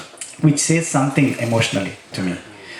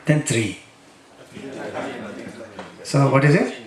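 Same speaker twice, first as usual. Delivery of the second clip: ordinary talk, nothing marked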